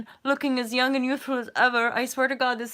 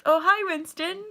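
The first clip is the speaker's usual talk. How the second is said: ordinary delivery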